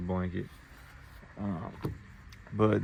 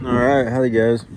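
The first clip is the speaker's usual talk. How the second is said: shouting over wind; 3 semitones higher, 7 dB louder than usual. Real, not acted. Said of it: "hear me through the wind".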